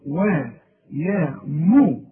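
ordinary delivery

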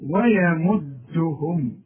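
ويمدهم slowly with thin waw and ya; thin letters. Shown as a demonstration. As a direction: draw out ويمدهم lightly. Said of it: "اوعى تفتح لي الميم". (ويمدهم مرققة وببطء)

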